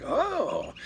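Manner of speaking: high, soft startled "oh"; surprised oh. Retold or imitated. Hearing coyotes at night, soft startled oh. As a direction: react startled to the yips. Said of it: "and I'm like".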